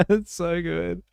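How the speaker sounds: ordinary talk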